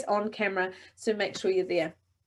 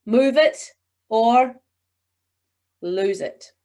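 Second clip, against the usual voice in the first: slow "Move it or", long pause, "lose it"; suspense; 6 dB louder, much slower than usual. Shown as a demonstration. Coach repeating the suspense version for the class. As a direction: hold the pause before "lose it". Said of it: "So try that".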